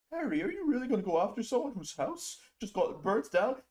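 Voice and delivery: high, put-on whiny voice; mock scolding critic. Streamer mimicking critics, high whiny voice. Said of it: "'cause it's like".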